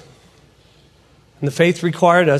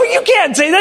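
high-pitched, animated objection; incredulous protest; 13 semitones higher, 3 dB louder than usual. Retold or imitated. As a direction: object in disbelief, voice raised high. Speaker protests to pastor.

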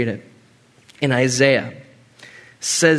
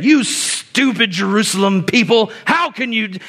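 mock scolding; 8 semitones higher, 3 dB louder than usual. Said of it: "he's not saying".

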